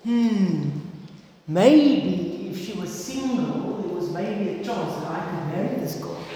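scheming thought. Acted. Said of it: "he heard these plans in his head".

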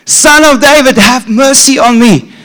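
acted blind beggar shouting out; excited shout. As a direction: build to a high, loud cry. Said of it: "Let me shout".

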